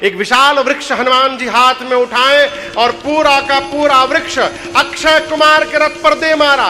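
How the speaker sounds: loud, high, building to the blow; rising shout. A narrator's reading. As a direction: hurl the line like the tree. Climax: Hanuman hurls the tree.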